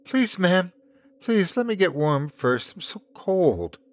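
put-on high, pleading little-girl voice; heartbreaking child's plea. A narrator's reading. Cold child begs to warm up first, pitifully. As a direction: plead like a small child who is freezing. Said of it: "The tone in her voice broke Della's heart".

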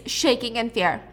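usual speaking voice

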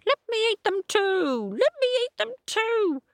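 high cackling witch voice. A narrator's reading. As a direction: screech it like a hungry witch. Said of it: "a witch on the roof shouting".

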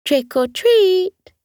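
high, slow sing-song coo. Acted. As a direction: coo it sweetly at the door. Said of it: "trotted up to the door and cooed".

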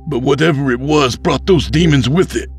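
put-on scornful young man. Tep mocks the pastor's angel, scornful. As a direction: cut his faith down.

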